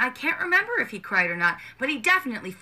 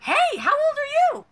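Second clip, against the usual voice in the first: put-on high, slow, sweet "how old are you"; mock customer service sweetness; innocent toddler voice; 3 dB louder, slower than usual. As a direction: ask like a curious toddler. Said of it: "I whipped around and went".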